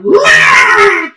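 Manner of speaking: shrieked high wail, loud; a cartoonish fairy's shrill cry; high-pitched fairy cry. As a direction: squeal like the Scrooge fairy. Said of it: "that high-pitched voice on Scrooge".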